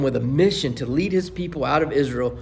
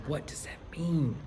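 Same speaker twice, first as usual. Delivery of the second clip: whisper; 2 semitones higher, 8 dB quieter than usual. Acted. Preacher's sudden hushed rhetorical question.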